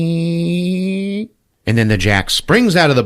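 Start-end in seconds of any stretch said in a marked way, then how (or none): 0.0-1.3 high squeaky toy sound; toy squeak. Retold or imitated. Jack in the box unwinding.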